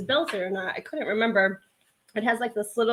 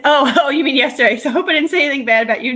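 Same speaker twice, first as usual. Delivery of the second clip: laughing surprise; 5 semitones higher, 10 dB louder, faster than usual. Real, not acted. Reacting to a chat comment.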